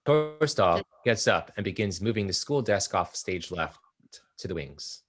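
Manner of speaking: ordinary talk, nothing marked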